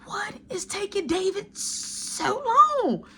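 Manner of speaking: high, slow, mock-whiny impatience; high drawn-out complaint; impatient wondering. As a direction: stretch it out like you're fed up waiting. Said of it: "So I'm like".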